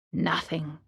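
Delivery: soft hushed pause. A narrator's reading. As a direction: let it drop to a hush. Suspense after waiting.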